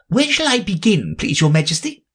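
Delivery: high respectful rabbit voice. Acted. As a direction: politely correct the king, high. Rabbit asks.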